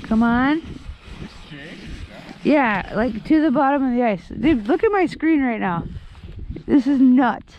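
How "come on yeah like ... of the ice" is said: usual speaking voice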